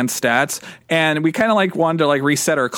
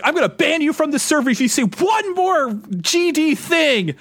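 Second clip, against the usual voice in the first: high, strained mock yell; imitated screaming threat; 9 semitones higher than usual. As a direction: act out a furious admin losing it. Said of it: "just screaming at us".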